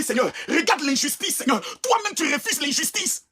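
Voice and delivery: slow, high lament calling on God; pleading prayer. Acted. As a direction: cry out to the Lord slowly.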